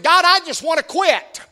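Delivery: high whining plea; whiny man child. Retold or imitated. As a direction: whine it like a tired child. Preacher voices whining Elijah.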